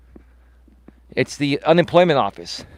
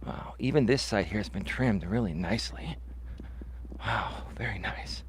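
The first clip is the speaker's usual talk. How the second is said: soft murmur to himself; quiet aside; 7 semitones lower, 16 dB quieter than usual. Real, not acted. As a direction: say it under your breath. Aside about the trim.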